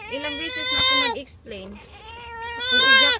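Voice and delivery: high, crying exasperation; upset, near tears. Real, not acted. Mom overwhelmed by kids' complaints, crying out. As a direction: voice cracking from frustration.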